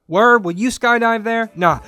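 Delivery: lightly put-on slangy street accent; lightly put-on street slang voice. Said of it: "I'm doing that accent thing".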